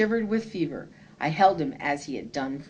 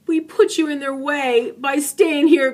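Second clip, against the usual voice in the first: higher, louder anguished cry; grief-struck cry; 8 semitones higher, 6 dB louder than usual. A narrator's reading. Pink rocking dying Momo Bay, anguished cry. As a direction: blame yourself aloud over her body. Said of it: "Pink cried".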